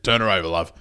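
low, easygoing bloke; Man Cam voice. Retold or imitated. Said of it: "has a lower voice".

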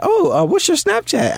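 a guy voicing a girl who wants his Snapchat; mock girl voice. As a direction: play the eager girl asking, way up high. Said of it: "she's like".

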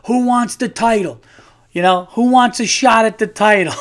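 high, loud cocky challenge; mock trash talk. Calling out challengers at a backyard party, loud and cocky. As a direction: hype up the backyard crowd. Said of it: "I'm like".

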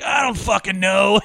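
exasperated. Retold or imitated. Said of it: "He's like".

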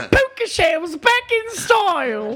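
slow, high paperboy cry; newsboy headline call. Pretend. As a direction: call it out like breaking news. Hawking a mock headline.

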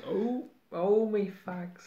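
a light Cockney cat voice, high. Retold or imitated. Cat voiced in Cockney, a hint of London. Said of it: "I read it like it were a Londoner".

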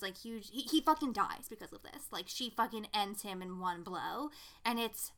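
ordinary delivery

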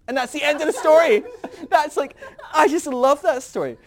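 high, loud laughing joy; loving the story, laughing loud; laughing delight. Real, not acted. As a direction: laugh while you say it.

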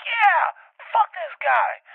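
very high, loud, slowed; defiant yell. Retold or imitated. Host telling producers he'll jump out of spite, yelling. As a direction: relish the spite.

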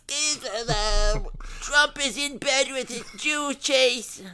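high, loud whiny outrage voice; cancel them voice. Pretend. Parodying an online conspiracy ranter, whiny shriek. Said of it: "I'll go back to my cancel them voice".

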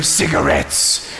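very low, slow punchline voice; mock-grave low answer to a kid; deadpan comic reply. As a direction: drop deep and stretch the word out. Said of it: "and I go".

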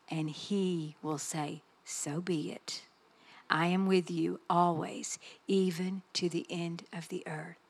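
slow, hushed, low delivery with long pauses; solemn preaching. Real, not acted. Preacher closing her message to the room, solemn and hushed. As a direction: let each phrase land softly and gravely.

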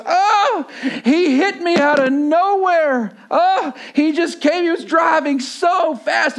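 mock-hysterical wail, high and drawn out; yelling in accusation. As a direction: imitate an outraged accuser, slow high yelling. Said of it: "And she's yelling".

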